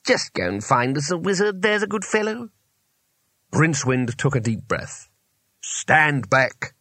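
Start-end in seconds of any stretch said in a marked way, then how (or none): 0.1-2.5 coaxing, rising to a high finish; forced kindliness. A narrator's reading. Trapped wizard sending them for help, forced kindliness. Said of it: "as kindly as it could manage". 5.6-6.7 understated rasped order; a mildly rasped warning. A narrator's reading. Rincewind ordering everyone from the door, mildly rasped. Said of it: "he rasped".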